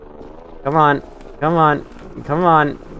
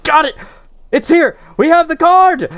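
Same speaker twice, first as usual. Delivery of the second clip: much higher, thrilled cry of triumph; excited shout; 4 dB louder than usual. Real, not acted. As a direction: yell out the win, overjoyed. Finally grabs the key card.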